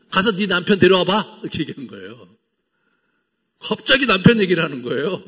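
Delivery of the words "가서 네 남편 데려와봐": louder, higher decisive command; Jesus striking the woman's weak spot; pointed blow. Retold or imitated. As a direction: land the knockout line. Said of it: "결정타를 날리십니다".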